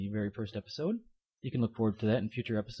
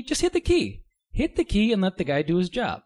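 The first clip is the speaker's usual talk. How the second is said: exasperated; 7 semitones higher, 6 dB louder than usual. Real, not acted. Yelling at the Count.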